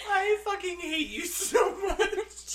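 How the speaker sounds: veel hoger en langzaam, gespeelde wanhoop; mock exasperation. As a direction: doe alsof je haar ondraaglijk vindt. Mock exasperation at cohost's bit.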